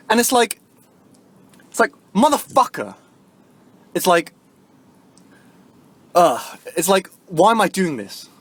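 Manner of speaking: high, loud exasperated rant; livid outburst. Real, not acted. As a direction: shout your frustration at yourself. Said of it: "I'm still livid".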